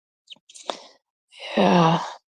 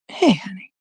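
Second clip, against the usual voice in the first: whispered greeting; 5 dB quieter than usual. Retold or imitated. Mock sheepish reply.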